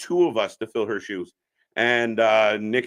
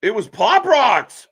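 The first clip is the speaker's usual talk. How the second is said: excited exclaim; 9 semitones higher, 7 dB louder, slower than usual. Real, not acted. Reacting to cage action live.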